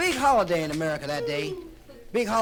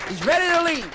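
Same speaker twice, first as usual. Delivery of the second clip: much higher, much louder shouted call; full shout; 9 semitones higher, 9 dB louder than usual. Acted. Narrator hyping Chris's departure at full shout. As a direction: belt it out like a crowd hype.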